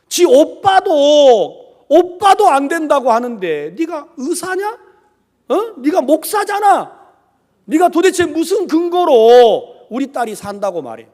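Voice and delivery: loud, high challenge; angry confrontation. Retold or imitated. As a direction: act out an indignant father demanding answers. Brother-in-law confronting the preacher.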